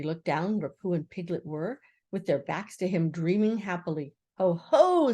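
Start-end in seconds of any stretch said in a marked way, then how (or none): 4.4-5.1 loud, high, sudden call. A narrator's reading. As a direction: burst out with it. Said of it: "said Christopher Robin loudly and suddenly".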